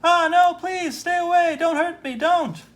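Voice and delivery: high, loud terrified begging; terrified plea. Acted. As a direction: scream for mercy, voice far up. Clerk attacked, screaming.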